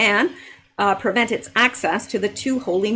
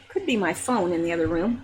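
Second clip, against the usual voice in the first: normal talking voice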